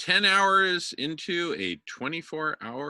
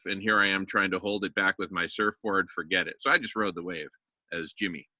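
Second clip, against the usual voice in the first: ordinary delivery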